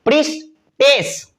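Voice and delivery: प्रीस्टेस said slowly and sung out; प्रीस्टेस lingered over like a chant; drawn-out teaching word. Shown as a demonstration. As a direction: stretch प्रीस्टेस slowly, syllable by syllable. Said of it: "E double S लग जाएगा".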